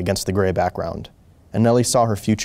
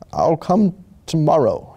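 ordinary delivery

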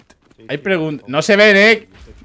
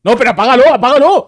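urgent shout; 7 semitones higher, 6 dB louder than usual. Real, not acted. Urgent demand to teammate.

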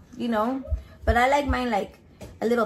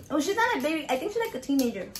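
normal talking voice

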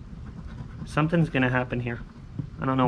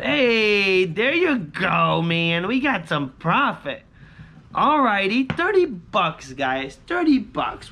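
excited; 6 semitones higher, much slower than usual. Real, not acted. Small profit, excited.

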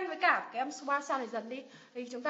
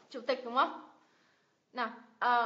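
ordinary talk

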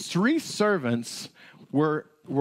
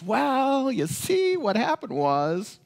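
high, hesitant excuse-making; pitched-up nervous excuses; 10 semitones higher, slower than usual. Acted. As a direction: squirm through the excuse. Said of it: "he started making all these excuses".

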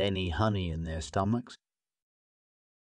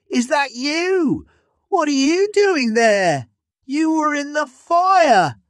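very high, loud disbelieving shout; shocked shout; 9 dB louder than usual. Acted. Lion shouts up at the monkey he thought burned, shocked. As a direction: yell up at the tree in shock.

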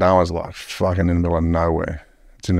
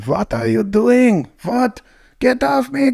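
much higher, slow, panicked put-on Swedish accent; fake Swedish tourist; slower than usual. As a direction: play a startled Swedish backpacker protesting the arrest in a high voice. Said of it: "I'm from Sweden".

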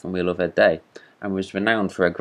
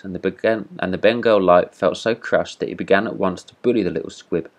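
ordinary delivery, nothing marked

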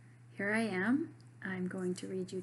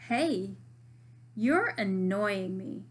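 annoyed; 8 dB louder, much slower than usual. Acted. Marisol annoyed at Jackson.

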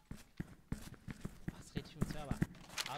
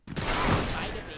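cover me shout; 2 semitones higher, 13 dB louder than usual. Real, not acted. Callout of enemy positions.

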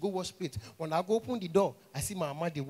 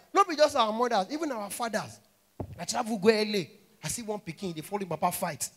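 ordinary talk

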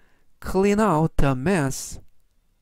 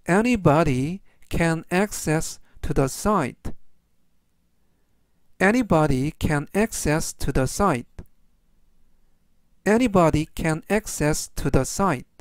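slow, measured English for learners; teacher's deliberate, word-by-word pace; slow model reading; slower than usual. Shown as a demonstration. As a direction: read it out slowly for repeat-after-me. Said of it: "천천히 세 번씩 읽어드리고요".

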